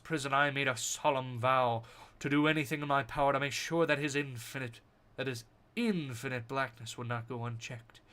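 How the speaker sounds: epic storyteller voice, building to proclamation; dramatic narration. A narrator's reading. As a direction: read it like a grand comic narrator.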